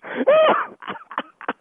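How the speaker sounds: real squealing laughter; high-pitched laugh. Real, not acted. Host cracking up at fooled victim, high squealing laugh.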